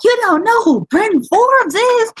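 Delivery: high-pitched, drawn-out mock disbelief; slow, high incredulous question; retold incredulity. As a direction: imitate an outraged, incredulous sports pundit. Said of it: "he was like".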